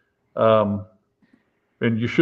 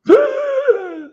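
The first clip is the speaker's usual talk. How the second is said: a big, high gasp of air, like coming back to life; sharp revival gasp; 4 dB louder than usual. Retold or imitated. The revived guy gasping after the chest thump. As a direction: suck in air as if jolted awake. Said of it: "And this guy goes".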